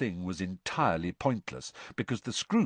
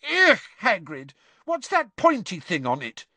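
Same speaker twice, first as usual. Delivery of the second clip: put-on disgusted girl; 11 semitones higher, 6 dB louder than usual. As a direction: squeal at the ugly creature. Lavender disgusted by the scroot.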